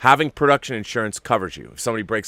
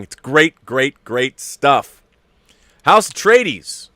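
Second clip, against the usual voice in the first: loud enthusing; 5 semitones higher, 8 dB louder, much slower than usual. Real, not acted. Enthusing then loud name.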